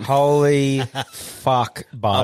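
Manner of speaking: slow, laughing through the burn; drawn out, mouth on fire; mouth burning from spicy food. Real, not acted. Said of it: "my mouth is on fire".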